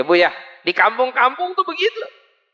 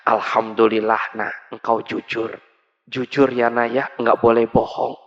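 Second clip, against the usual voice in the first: tender parent; 12 semitones lower than usual. Acted. Proud parent praises child.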